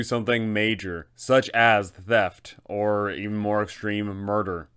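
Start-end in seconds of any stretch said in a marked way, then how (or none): none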